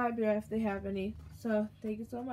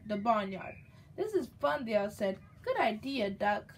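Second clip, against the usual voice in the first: lively, louder storybook read-aloud; reading to a child; 5 dB louder than usual. A narrator's reading. Mother reading a picture book to her baby, lively storybook voice. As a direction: read it out brightly for the little one.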